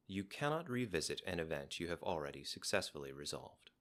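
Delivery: ordinary talk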